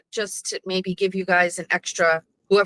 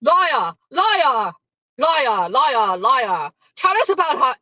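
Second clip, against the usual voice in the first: high, loud Australian heckling; hammed-up Australian chant of accusation; mock Australian accent; 5 semitones higher, 5 dB louder, slower than usual. Imitating John heckling on air, mock Australian accent. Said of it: "How do you do an Australian accent?".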